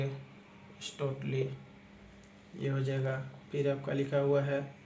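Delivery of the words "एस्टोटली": एस्टोटली drawn out very slowly and softer while writing; एस्टोटली said very slowly and quietly, as if writing it. Shown as a demonstration. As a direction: stretch एस्टोटली out softly, syllable by syllable. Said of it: "एस्टोटली इस तरह करेंगे".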